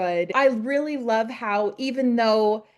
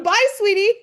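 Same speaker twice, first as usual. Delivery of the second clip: called-out goodbye; 9 semitones higher, 5 dB louder than usual. Retold or imitated. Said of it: "Nathan yells out".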